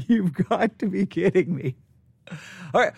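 laughing disbelief. Retold or imitated. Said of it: "I looked and I'm like".

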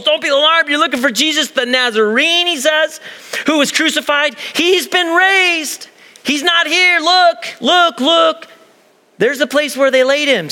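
angel boldly announcing the empty tomb; bold angel announcement. Acted. Angel's words read with force.

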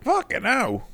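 high-pitched, real disbelief; shocked exclamation. Real, not acted. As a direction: exclaim it high, genuinely taken aback. Shocked at anaphylaxis from steak.

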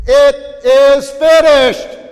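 huge, high, drawn-out proclamation; full-force, slow triumphant cry; loud voice. Acted. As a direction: shout it slowly, word by word, to the whole crowd. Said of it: "says in a loud voice".

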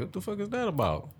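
high, incredulous question; hammed-up shock at a ring; mock disbelief. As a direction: pitch it way up in disbelief. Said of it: "I'd be like".

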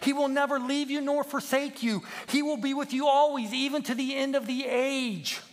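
high, loud impassioned plea; urgent rallying. Acted. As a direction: beg the flock to follow and trust. Peep urgently calls the sheep again.

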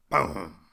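big booming blast, low and loud; a cannon roars. Retold or imitated. Cannon fires again.

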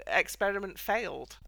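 ordinary delivery

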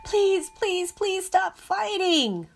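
high, loud, slow wailing please. A narrator's reading. Clacy begging the quarrelling voices to stop, wailing. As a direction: beg them to stop, voice climbing into a wail. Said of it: "wailed Clacy".

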